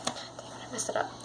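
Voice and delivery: hushed, barely voiced self-reproach; whispered aside. Real, not acted. Storyteller to self after fluffing the ghost's line, whispered. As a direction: curse yourself under your breath.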